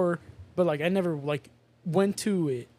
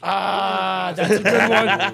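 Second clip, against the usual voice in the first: laughing; 8 dB louder, much slower than usual. Real, not acted. Laughing at Illuminati question.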